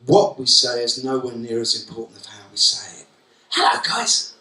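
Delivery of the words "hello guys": much higher, exaggerated sing-song hello; over-the-top greeting. Shown as a demonstration. Teacher hamming up a greeting to Jordan and Courtney, far too high. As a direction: overdo the friendliness until it turns a bit mad. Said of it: "Unthreatening, okay, but a bit mental".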